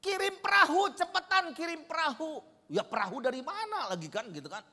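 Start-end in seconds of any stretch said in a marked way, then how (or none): 0.0-2.4 high, loud urgent plea for a boat; panicked husband yelling to his wife; retold shout. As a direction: scream for a boat, fast. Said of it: "berteriak-teriak gitu".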